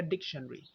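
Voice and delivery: डिक्शनरी as dik-shun-ree; schwa dropped. Shown as a demonstration. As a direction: say डिक्शनरी in three syllables. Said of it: "इसको निकाला तो ये बन गया". (डिक्शनरी छोटा करके: डिक-शन-री)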